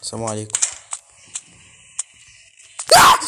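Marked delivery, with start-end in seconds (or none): 2.9-3.2 short, loud cry of fright; startled scream. Real, not acted. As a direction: yelp at a sudden scare. Jump scare in horror game.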